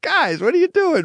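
very high-pitched retold plea; mock protest. Guest to the hosts cracking up, mock protesting.

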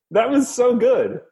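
high, slow, emphatic praise; gushing praise. Real, not acted. Cohost begging him to keep the story in, high and slow gushing. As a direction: stretch it out with delight. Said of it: "Don't cut it".

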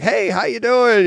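high, drawn-out host greeting; mock upbeat show opener; put-on show cheer. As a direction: greet the audience with switched-on energy. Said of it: "calls it putting your makeup on".

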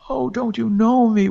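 high, loud wailing plea; ghostly wail. Acted. Ghost wife wails at her husband who does not know her. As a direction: wail it as a grieving spirit. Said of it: "wailed the voice".

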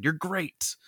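breathless starstruck whisper; awed whisper. Retold or imitated. Floyd telling Superman he admires him, in a whisper. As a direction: whisper it like a fan meeting an idol.

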